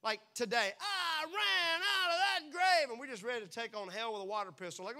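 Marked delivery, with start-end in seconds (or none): 0.8-2.9 slow, high, loud triumphant cry; hammed-up victory shout; fired-up boast. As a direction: crow it like you just won. Fired-up believer, retold.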